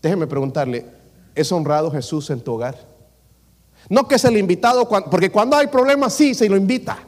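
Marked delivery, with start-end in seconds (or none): 3.9-7.0 higher, louder, hammed-up alarm; mock emergency plea. Preacher imitating a panicked household, hammed-up and louder. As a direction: act out the family that only calls God in a crisis.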